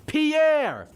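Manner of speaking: loud, high, drawn-out yell; trolling yell. Retold or imitated. Doofenshmirtz trolling everyone over Paris, mock yell. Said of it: "he trolls everyone by yelling".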